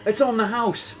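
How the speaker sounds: high, loud announcement; bartender calling out. Retold or imitated. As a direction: holler it over the bar noise. Acts out bartender's reply in bar.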